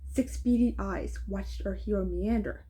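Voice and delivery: plain reading voice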